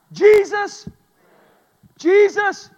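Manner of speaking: shouted high and stretched; drilling the verse. Shown as a demonstration. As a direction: call out the name to stamp it in. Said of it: "you've learned one".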